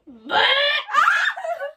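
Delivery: high, loud burst of laughter. Real, not acted. Loud laugh after beeps.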